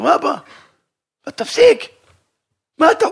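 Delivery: son shouting at his father, high and loud; angry son. Acted. Son yelling at his father to stop. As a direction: snap back at the father in outrage.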